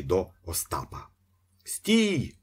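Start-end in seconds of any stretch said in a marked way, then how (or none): 1.6-2.3 high-pitched halting cry; bawling. A narrator's reading. Ostap ordering the priest to stop, high bawl. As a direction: call the halt up high. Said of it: "загорлав Остап".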